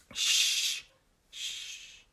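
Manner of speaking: long hushing "sh-", on its own; isolated "sh" sound. Shown as a demonstration. Teacher isolates the first sound, drawn-out "sh-". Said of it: "How do I pronounce this word?".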